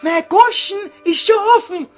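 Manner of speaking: hammed-up falsetto girl, calling to both men; Gretel's high voice. Gretel appears, voiced by the performer.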